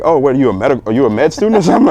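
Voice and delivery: mock a nurse's amazement, fast and high; surprised nurse.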